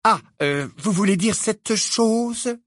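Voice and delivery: slow, distasteful drawl; disgusted. A narrator's reading. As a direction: hold it at arm's length. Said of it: "d'un ton dégoûté".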